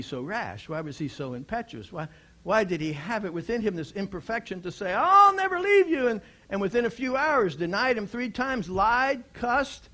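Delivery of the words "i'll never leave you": high, loud, fervent vow; Peter's boast. Retold or imitated. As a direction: swear it loudly like a bold disciple.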